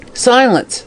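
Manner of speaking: sharp command. A narrator's reading. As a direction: snap it out high and firm. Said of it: "said Kilsip sharply".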